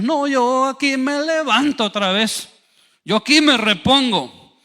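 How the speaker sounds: high, slow, emphatic vow; mock determination. Fallen believer vowing to get up, mock confident. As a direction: declare it with overdone confidence. Acted overconfident vow to recover.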